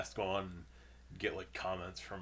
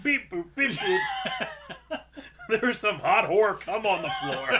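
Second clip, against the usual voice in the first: high squeaky robot voice; 13 semitones higher, 11 dB louder than usual. Retold or imitated. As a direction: beep like a chirpy store robot, laughing. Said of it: "Marty's like".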